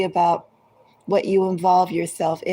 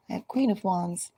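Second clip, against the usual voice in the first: ordinary talk, nothing marked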